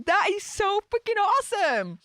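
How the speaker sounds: high-pitched gush, sliding down at the end; excited raving. Real, not acted. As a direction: gush over a favourite tune. Raves after humming the game tune.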